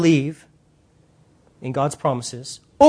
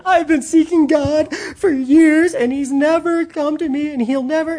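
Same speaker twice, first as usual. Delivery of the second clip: complaining seeker; 7 semitones higher than usual. Retold or imitated. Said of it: "how many times have I heard".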